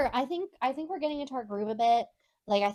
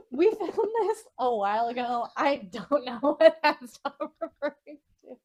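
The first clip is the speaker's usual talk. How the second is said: slow, loud words breaking into laughter; laughing; 5 semitones higher, 7 dB louder than usual. Real, not acted. Podcast host baffled by a friend's soup comment, laughing. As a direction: laugh helplessly through the sentence.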